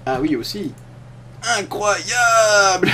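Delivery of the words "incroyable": drawn-out, far-off TV pitch voice; infomercial. Acted. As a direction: stretch it like a shopping-channel host. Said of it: "On dirait une parodie de téléachat".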